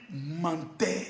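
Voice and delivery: low, soft, drawn-out word; quiet accusation. Acted. Closing the chant on the liar, low and soft. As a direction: drop down and say it softly.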